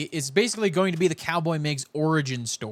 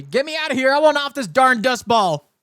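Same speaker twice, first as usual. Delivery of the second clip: high, loud cowboy holler; hammed-up desperate cowboy yell; cowboy character's outburst; 10 semitones higher, 8 dB louder than usual. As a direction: holler it like a fed-up cowboy demanding rescue. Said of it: "he can crash into Last Survival and just immediately".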